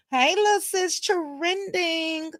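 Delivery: high-pitched singsong greeting. Shown as a demonstration. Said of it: "I can't say it like that".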